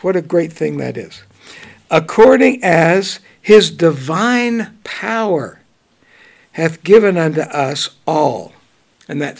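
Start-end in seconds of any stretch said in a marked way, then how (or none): 1.9-8.5 scripture reading. Acted. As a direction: read the verse out with weight. Reads scripture aloud.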